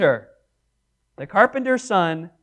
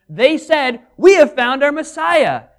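loud, higher proclamation of the Messiah; excited report; 8 semitones higher, 9 dB louder than usual. Acted. John telling Greek readers, loud and excited. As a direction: announce it like news you can't hold in. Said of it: "he says to them".

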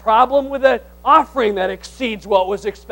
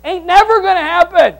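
loud, high, emphatic denial; preacher shutting down an excuse; forceful retort; 8 semitones higher, 10 dB louder than usual. Real, not acted. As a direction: slam it down hard.

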